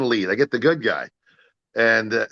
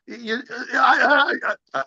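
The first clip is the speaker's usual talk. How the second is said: stammering flustered. Retold or imitated. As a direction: stumble over the words, caught off guard. Stammering at the short notice.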